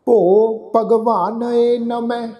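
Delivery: drawn-out, syllable by syllable scripture reading; slow recitation. Shown as a demonstration. Teacher reciting the hymn slowly for the congregation. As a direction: lay each syllable down slowly and reverently. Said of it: "ਇਸ ਦਾ ਉਚਾਰਨ ਅਸੀਂ ਨਮੈ".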